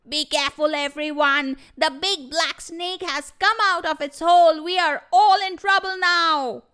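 high, loud alarmed cry. Acted. Porcupine warns the animals about the snake, loud alarmed cry. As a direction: raise the alarm for everyone. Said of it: "started crying out loud".

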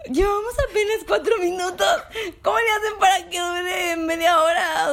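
high giggly voice through laughter; laughing while talking. Real, not acted. Giggling fit after a joke.

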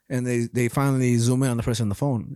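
normal talking voice

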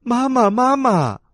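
put-on high, loud childlike call for mama; eager, high-pitched shout. A narrator's reading. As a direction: shout after her like little tadpoles. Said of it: "追上去喊".

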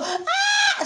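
high-pitched imitated shriek; mock scream. Two women screaming during a bank robbery, mock scream. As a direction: squeal like a screaming hostage. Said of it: "hay dos tipas gritando".

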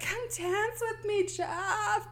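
put-on high, drawn-out drunken pleading; mock drunk woo girl. Drunk Amy begging Jeff to dance, mock tipsy plea. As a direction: whine it out like a tipsy party girl. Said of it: "Amy is that drunk bitch".

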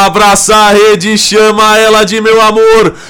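goal celebration call. Real, not acted.

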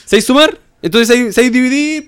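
high, loud parody questions; loud high-pitched mock interrogation; mock quizzing. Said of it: "Es como cuando te dicen".

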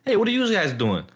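high-pitched hammed-up Italian-American greeting; mock Italian-American accent. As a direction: call out as a pretend Italian-American. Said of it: "you can't do an Italian accent".

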